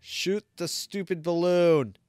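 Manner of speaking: loud, slow exasperated groan; fed up with the balloon. Real, not acted. Frustrated at game.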